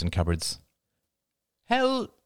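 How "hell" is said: put-on high-pitched small boy crying out; high childish exclamation; child's cry. A narrator's reading. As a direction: cry out like an indignant little kid. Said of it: "cried Ben".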